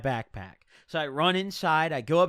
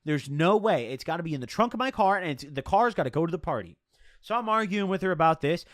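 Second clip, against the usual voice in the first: ordinary delivery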